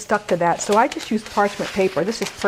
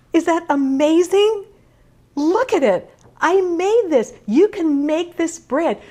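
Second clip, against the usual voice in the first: high, bright, proud delight; excited; 7 semitones higher, slower than usual. Real, not acted. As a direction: brag happily about the loaf. Proud of finished bread.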